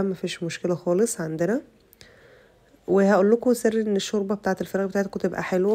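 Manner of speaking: ordinary talk, nothing marked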